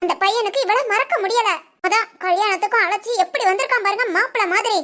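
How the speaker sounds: usual speaking voice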